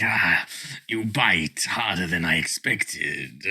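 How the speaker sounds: slow, low dragon voice; a taunting dragon, slow and low. Acted. Dragon speaks to player.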